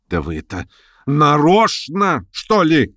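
high, drawn-out indignation, moderate volume; angry cry. A narrator's reading. Hostess accusing the schoolboy, crying out in anger. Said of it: "крикнула в гневе мадам Вергинская".

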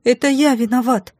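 put-on high child's confession; high, fearful small-boy voice; frightened prince babbling. A narrator's reading. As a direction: confess as a scared little boy. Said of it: "испуганно пролепетал принц".

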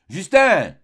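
Justin shouted loud and high with impatience; impatient shout. Acted. The apothecary shouting for his servant, impatient yell. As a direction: bellow the name from the next room, losing patience. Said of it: "cria l'apothicaire qui s'impatientait".

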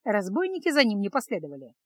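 ordinary delivery, nothing marked

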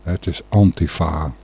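normal talking voice

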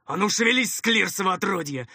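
rough commander shouting, building in force; angry yelling. A narrator's reading. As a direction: roar orders at a useless underling. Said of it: "заорал второй голос".

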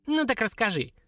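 taunting dwarf. Acted.

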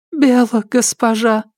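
frightened, high ghost woman; timid servant ghost; sobbing ghost voice. Acted. Ghost woman answers mistress.

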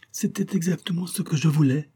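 high-pitched, sulky insistence; stubborn. A narrator's reading. Wife still wanting the necklace, stubbornly insisting. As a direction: dig your heels in. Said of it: "s'entêta Mister Wells".

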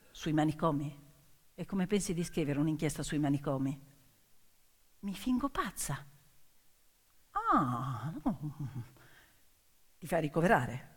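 lightly voiced skeptical editor. Pulitzer questioning her plan.

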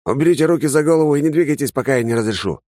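high, fast, loud command through a mask; masked soldier order. Acted. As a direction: rattle off the orders like a trooper.

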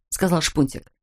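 usual speaking voice

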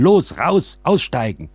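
hurried order to jump out, shouted; urgent command. Acted. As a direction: bark them off the train. Ordering everyone off the train.

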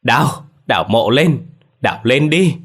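high, loud frantic shout; grieving mother shouting at villagers to dig up the grave; shouting loudly. A narrator's reading. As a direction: yell the order to dig at the crowd. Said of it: "bà đứng dậy hét lớn".

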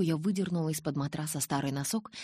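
plain reading voice

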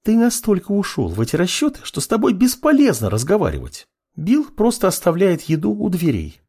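high-pitched female character, exasperated; exasperated girlfriend. A narrator's reading. Girl to apprentice lost in calculations, exasperated. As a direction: scold him in a high woman's voice. Narrator voices exasperated Levitania.

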